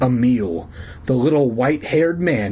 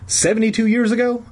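high-pitched, pressing question; insistent questioning; 10 semitones higher than usual. Acted. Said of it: "I insisted".

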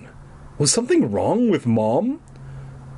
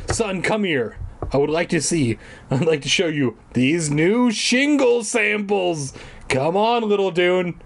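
hammed-up doting roofer dad calling his kid; imagined dad; 6 semitones higher than usual. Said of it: "he wasn't like".

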